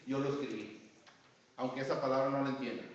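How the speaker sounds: normal talking voice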